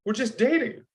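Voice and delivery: high-pitched dawning insight; sudden realisation. Retold or imitated. Actor works out the business on his way home, sudden realisation. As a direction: retell the moment it clicks, voice lifted. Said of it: "And then it hits me".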